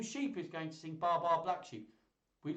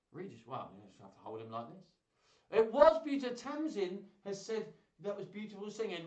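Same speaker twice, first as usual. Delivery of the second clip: normal talking voice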